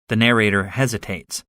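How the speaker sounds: usual speaking voice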